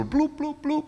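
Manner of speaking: high, popping bloops; imitated bubble bursts. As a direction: pop out little high bubble noises. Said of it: "words came out like a bubble".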